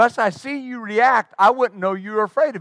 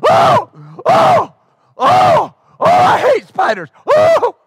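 high, loud panicked shrieks; imitated screaming; 8 dB louder, slower than usual. Preacher imitating the deputy screaming at a spider. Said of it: "when he started screaming".

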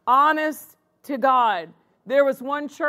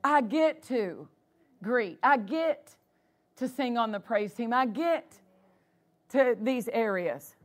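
grateful attitude; 3 semitones lower, 6 dB quieter than usual. Shown as a demonstration. Preacher models a servant's attitude to church, softly grateful. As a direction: speak it like a quiet, thankful vow. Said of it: "That needs to be our attitude".

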